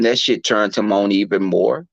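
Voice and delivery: usual speaking voice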